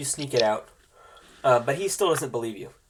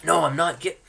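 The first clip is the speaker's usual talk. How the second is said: higher, louder pleading denial; desperate denial; 4 semitones higher, 9 dB louder than usual. Acted. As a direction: insist he's honest. Legolas denying, desperate.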